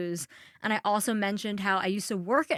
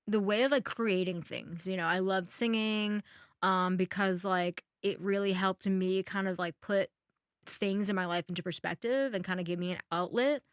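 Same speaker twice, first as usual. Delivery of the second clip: ordinary talk, nothing marked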